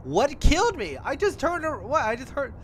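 high, loud panicked cries; shrill alarmed shouting in disbelief; panicked yelling. Real, not acted. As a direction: freak out after a sudden death. Panicked after dying in game.

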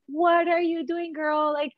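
high, chiding inner-conscience voice; the inner voice scolding her for craving junk. Retold or imitated. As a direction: play her spiritual heart scolding her. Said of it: "my spiritual heart was like".

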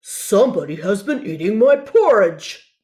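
a big papa bear roaring at his bowl; booming papa bear voice with a mock roar. A narrator's reading. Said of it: "roared Papa Bear".

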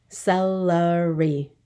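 "Celery" slowly in three beats, ce-le-ry; syllable by syllable. Shown as a demonstration. Teacher counting for the class, "Celery" slowly split up. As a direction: stretch "Celery" out one syllable at a time. Syllable lesson, word broken up.

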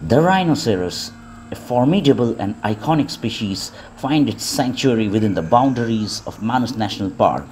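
calm, even wildlife narration; nature documentary. Acted.

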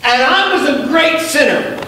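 high, very slow, ringing cry; soaring preaching. Real, not acted. Pastor testifying to the church about grace, soaring preaching. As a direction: stretch every word to the rafters.

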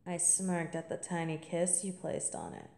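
normal talking voice

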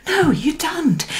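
soft high whisper; a gentle whispered no. Acted. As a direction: breathe it like a secret. Reassuring aside to listener.

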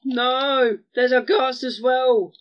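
loud alarmed cry, slow second line; panicked shout. Real, not acted. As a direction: yell in sudden panic. Panics at ghast.

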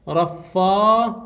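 رفاؤون slowly, ending held apart; drawn-out demonstration. Shown as a demonstration. Teacher shows students the masculine plural, slow drawn-out demonstration. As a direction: split and stretch رفاؤون.

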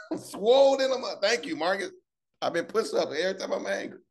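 hammed-up high voice, breaking up with laughter; Austin. Speaker mimics Austin thanking him for push-ups, hammed-up high voice. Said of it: "Austin gonna come around and be like".

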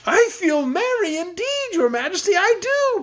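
high-pitched, over-eager insistence; anxious protest. A narrator's reading. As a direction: protest merriment to escape tickling. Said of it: "protested King Rinkitink, anxious to escape".

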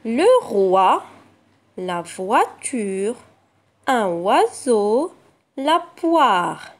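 each word said slowly and clearly; words read slowly for children. Shown as a demonstration. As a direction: read out every word carefully for the class. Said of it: "Passons aux mots".

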